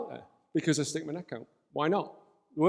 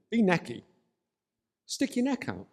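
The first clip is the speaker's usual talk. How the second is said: a touch of a barking army squaddy, pitched higher; squaddy soldier; 6 semitones higher than usual. Acted. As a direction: snap it out like a soldier giving an order. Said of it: "I'm gonna bring the soldier back in the room".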